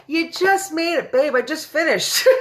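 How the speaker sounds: bright, high and loud welcome; excited high greeting. Real, not acted. Greeting a cousin who joined late, high and excited. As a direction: greet her like she just ran in.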